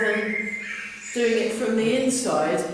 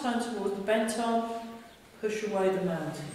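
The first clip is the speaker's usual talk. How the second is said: calm movement instruction; 5 dB quieter than usual. Acted. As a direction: guide the class gently through the form. Guided qigong movement cues.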